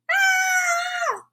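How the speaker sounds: sudden very high shout of relief; high triumphant cry. Acted. Family cries out together in high triumph.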